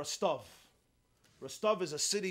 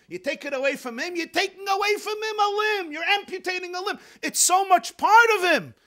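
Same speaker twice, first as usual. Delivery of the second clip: high, very loud, climactic sermon cry; impassioned preaching; 13 semitones higher, 12 dB louder than usual. Real, not acted. Butler's lesson to the baker, impassioned climax. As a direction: drive the point home, nearly shouting. Preacher drives home the point.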